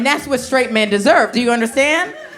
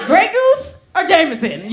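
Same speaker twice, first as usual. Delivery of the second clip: put-on bottle girl pitch; 7 semitones higher, 4 dB louder than usual.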